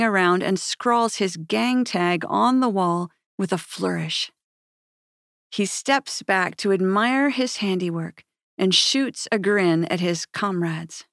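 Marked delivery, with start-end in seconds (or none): none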